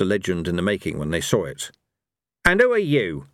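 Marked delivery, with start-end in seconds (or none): 2.4-3.3 high, curt angry question; angry snap at the lady; snapped. Acted. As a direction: snap it at the stranger. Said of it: "snapped Cohen".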